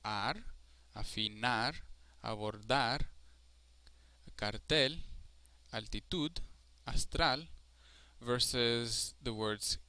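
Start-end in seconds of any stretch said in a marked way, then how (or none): 0.1-7.4 afinar, abordar, cartel, altitud, astral with strong endings; stress on last syllable. Shown as a demonstration. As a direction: land on each final syllable, from Ar to astral. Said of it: "is the last".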